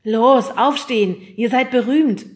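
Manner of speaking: loud, high rousing shout; loud call. A narrator's reading. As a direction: call out sharply to get sleepers up. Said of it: "rief Fred in einem Tonfall". (laut und aufgeregt gerufen)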